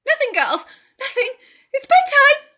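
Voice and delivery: high, loud, hurried brush-off; mother hiding the trouble from the twins; flustered dismissal. Acted. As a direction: shoo the girls off to bed.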